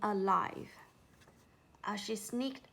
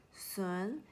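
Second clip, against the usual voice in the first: plain reading voice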